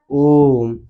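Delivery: ओम with a long held ओ; drawn-out vowel. Shown as a demonstration. Teacher gives class an example of a prolated vowel, ओम drawn out. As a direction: stretch ओम well past normal length. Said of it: "ज्यादा समय लग रहा है".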